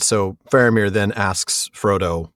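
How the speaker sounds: ordinary talk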